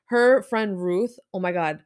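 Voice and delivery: ordinary talk, nothing marked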